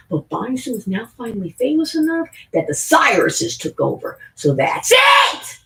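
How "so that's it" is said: slow, high shout of So that's it; a triumphant ranting yell. Real, not acted. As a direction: bellow it out in triumph. Ranting outburst.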